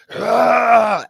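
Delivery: loud, high, drawn-out exasperated groan; frustrated groan. Retold or imitated. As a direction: let out a big upset "ugh". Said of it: "I am boisterous and upset".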